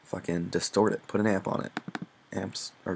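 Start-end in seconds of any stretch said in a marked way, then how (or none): none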